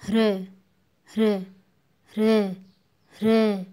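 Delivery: "ह्र" repeated firmly, light ह into र; उच्चारण demonstration. Shown as a demonstration. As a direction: teach "ह्र" in separate, increasingly loud takes. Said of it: "फिर से देखिए". ("ह्र" का ज़ोरदार, अलग-अलग उच्चारण)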